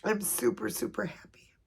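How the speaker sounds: high, tearful reassurance, fading to a whisper; real happy crying, voice thinning at the end; happy tears. Real, not acted. Reassuring viewers the baby makes her happy, through happy tears. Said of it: "It's happy tears too".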